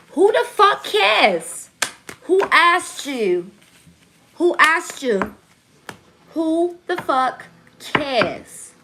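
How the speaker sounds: high, drawn-out indignant yell; exaggerated shout at nosy commenters; loud outburst. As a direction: holler it slowly, stretching every word. Mock outburst at unwanted commenters.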